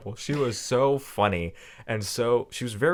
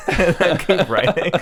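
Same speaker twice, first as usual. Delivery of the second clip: laughing; 7 semitones higher, 4 dB louder than usual. Real, not acted. Laughing mid-story.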